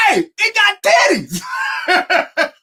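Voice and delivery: high, loud, stretched-out shout of disbelief; laughing yell; excited shout. Retold or imitated. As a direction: yell it out slow like you can't believe your eyes. Homies shouting on seeing creature.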